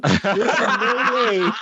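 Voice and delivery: slow, laughing through a mock disclaimer; can't keep a straight face reading the legal bit. Real, not acted. Disclaimer cracking up mid-read.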